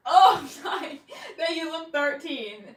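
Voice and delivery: giggling through the words; slowed by laughter; laughing. Real, not acted. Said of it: "'cause I died".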